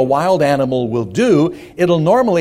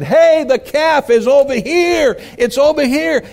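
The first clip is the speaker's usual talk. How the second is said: acted excited holler, pitched way up; 11 semitones higher than usual. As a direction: re-enact a kid hollering for help. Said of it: "I started hollering".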